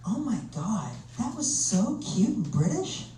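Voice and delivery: put-on soft, drawn-out American girl voice; gushing American broker. Broker to the comedian after the biker scare, mock gushing. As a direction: coo it like a smitten American woman. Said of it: "she turns to me and goes".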